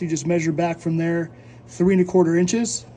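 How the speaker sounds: usual speaking voice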